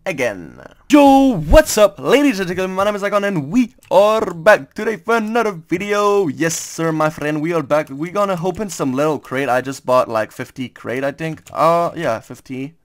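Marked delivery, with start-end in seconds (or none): 0.9-9.5 hype YouTube intro. Acted. Greeting viewers at the video start, high hyped host voice. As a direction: pump up the viewers like a show host.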